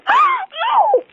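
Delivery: sharp high gasp of horror; startled intake of breath at the sight of the body; shocked gasp. Acted. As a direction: gasp as if you've just seen the corpse. Finds the murdered body.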